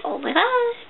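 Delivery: high, hushed swoon; swooning oh my gosh. Retold or imitated. Reviewer reacting to the hero's British accent, high hushed swoon. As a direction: gush softly, up high like a smitten fan. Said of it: "which I was like".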